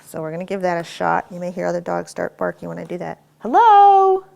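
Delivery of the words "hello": very high, loud doorbell hello; loud distraction hello. Shown as a demonstration. Trainer testing the dog with a loud greeting, high and loud. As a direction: sing it out like greeting a guest at the door. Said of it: "is when I say hello".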